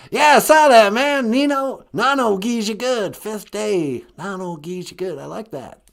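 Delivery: eager pupil in a caricature politician voice; George W. Bush. Acted. Caller shows off the Ojibwe phrase, hearty impression. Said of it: "is this George W. Bush?".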